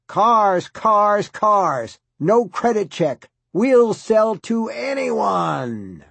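hammed-up local ad huckster yelling; huckster screaming.

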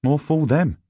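put-on high, insolent boy's voice; rude. A narrator's reading. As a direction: answer back cheekily as a boy. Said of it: "at once rudely and ungrammatically".

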